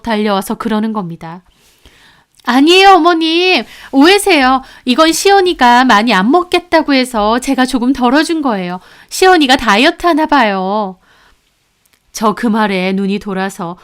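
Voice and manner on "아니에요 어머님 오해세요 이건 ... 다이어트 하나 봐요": put-on high, sweetly defensive young wife; flustered daughter-in-law, pitched high; daughter-in-law excusing herself. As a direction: talk your way out of it, innocently.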